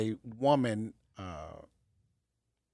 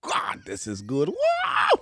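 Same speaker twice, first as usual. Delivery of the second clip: savoring delicious food; 2 semitones lower, slower than usual. Retold or imitated. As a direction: melt over the taste, then squeal with joy. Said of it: "And then you taste it and you're like".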